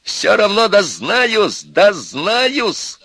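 high, loud, drawn-out yell; stretched high shout of defiance; yelling full force. A narrator's reading. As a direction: holler it from another room. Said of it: "заорал оттуда, что было силы".

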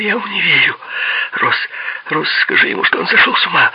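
very high, frightened woman's appeal. A narrator's reading. Mira backing away, begging Ross for help, frightened. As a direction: plead in alarm, voice pitched right up.

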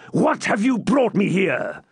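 high, slow, raging call to his men; furious outburst. Acted. Furious baron yelling at his servants, raging shout. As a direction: roar at the servants who blundered. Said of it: "exclaimed the furious Norman".

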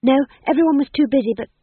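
high, loud and fast flustered. A narrator's reading. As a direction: blurt it out in a rush. Flustered Magrat.

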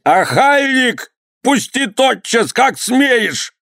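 very high, drawn-out shrieks; shouting. A narrator's reading. Governor struggling in the master's grip, shrieking. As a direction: squeal to be let go. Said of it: "не переставал кричать".

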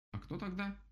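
высокий удивлённый птичий голос; спроси высоко, с удивлением; удивлённый дятел. A narrator's reading. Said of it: "удивился дятел". (удивлённо, голосом дятла)

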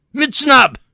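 very high, loud order; barked command. Acted. Tall man ordering salute to all, loud command. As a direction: snap the command like a drill sergeant. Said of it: "Ez a vezényszó".

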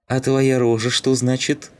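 high, jeering question; smirking taunt. A narrator's reading. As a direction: needle your opponent with a sneer in the voice. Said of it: "ухмыльнулся Скин". (насмешливо, высоким голосом)

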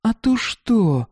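high, slow, challenging granny voice; defiant grandmother. A narrator's reading. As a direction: dare him like a tough old woman. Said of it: "спросила бабушка".

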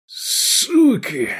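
high, strained cry of abuse; anguished curse. A narrator's reading. Whimpering man backs away cursing, anguished cry.

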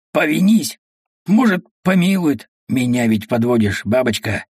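usual speaking voice

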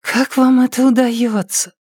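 put-on high, drowsy girl's voice; sleepy girl. A narrator's reading. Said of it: "уже сонная, совсем сонная".

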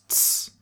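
unvoiced "ts" alone; just the consonant pair ts, no vowel; isolated ts sound. Shown as a demonstration. Teacher shows learners the German Z, bare ts sound. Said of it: "it is pronounced like T S".

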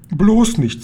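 a sudden scared plea; startled fright. A narrator's reading. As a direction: blurt it out in alarm. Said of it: "sank vor Schreck auf ihren Sitz".

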